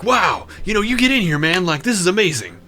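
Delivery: excited. Retold or imitated. Nick excited again.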